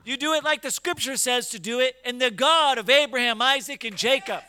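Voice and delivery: loud, rising preacher's cry; preaching at full force. Acted. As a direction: build the call into a shout to God.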